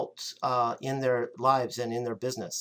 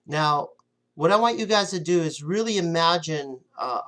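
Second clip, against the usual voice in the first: ordinary talk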